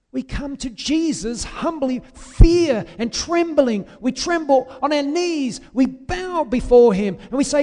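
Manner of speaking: high, loud fiery sermon voice; preaching at full force. Acted. Preacher warning his congregation, full-force preaching. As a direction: thunder it out like a revival preacher. Preacher describes humble approach to God.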